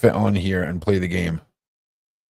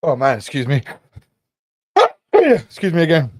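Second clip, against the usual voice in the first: after a sneeze; 10 semitones higher, 9 dB louder than usual. Real, not acted. Sneezing during the stream.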